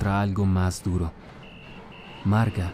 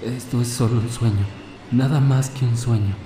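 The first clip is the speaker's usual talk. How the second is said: panicked self-reassurance; 4 semitones higher, 4 dB louder than usual. Acted. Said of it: "empezó a decir ... para sí mismo".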